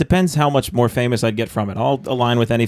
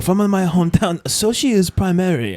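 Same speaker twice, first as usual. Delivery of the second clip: muddled mock foreign accent, higher and slower; Bobby Cody; 5 semitones higher than usual. Imitating an acquaintance's hometown talk in a botched foreign accent. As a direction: put on a garbled accent, drawn out and raised in pitch. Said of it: "He's like".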